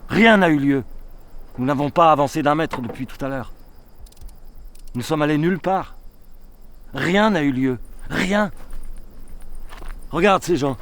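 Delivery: loud, high despairing cry; anguished outcry. Acted. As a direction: cry out that nothing happened. Don Quixote cries out in despair.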